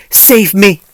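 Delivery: loud, panicked cry for rescue; frantic shout for help; panicked plea. A narrator's reading. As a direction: cry out as if being chased. Frantic friend fleeing her pursuer.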